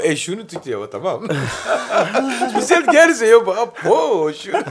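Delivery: high, laughing through the story; giddy laughing retelling. Real, not acted. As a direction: squeal with laughter while quoting. Telling about a girl, laughing.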